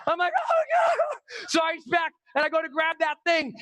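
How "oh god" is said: very high wail of dismay; mock shriek. Skydiver hit a second time, high dismayed shriek. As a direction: wail it in exaggerated shock.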